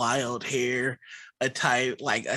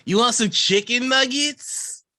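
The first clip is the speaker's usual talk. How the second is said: neighbor offering food; 10 semitones higher, 4 dB louder than usual. Acted.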